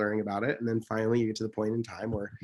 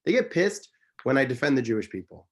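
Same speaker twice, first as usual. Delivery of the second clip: ordinary talk, nothing marked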